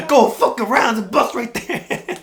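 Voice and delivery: very high, loud mock bellow, stretched at the end; angry shout. Dad shouting at the driver blocked by the bus, angry. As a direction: imitate a furious driver at full volume. Dad's shout retold louder.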